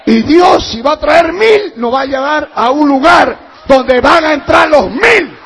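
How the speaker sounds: high, very loud Pentecostal shouting; full-force preaching. Real, not acted. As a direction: proclaim it at the top of your voice.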